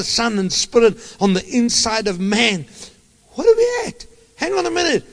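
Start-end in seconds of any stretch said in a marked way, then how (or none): 3.3-5.0 sudden exclamation. Real, not acted. Preacher breaks off mid-prayer.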